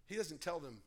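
usual speaking voice

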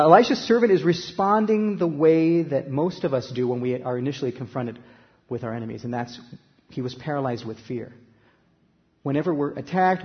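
ordinary talk, nothing marked

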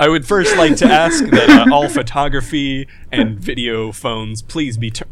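loud, formal press conference address; press conference speaker. Pretend. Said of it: "press, press release style".

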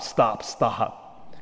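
normal talking voice

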